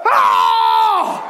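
high, sharp, shouted grunt like a hard swing; loud tennis grunt. Shown as a demonstration. Preacher shows how some must grunt to throw off cares, loud grunt. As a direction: let out a big forceful tennis grunt. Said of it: "grunt a little bit".

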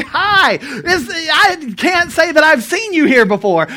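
friendly greeting. Pretend.

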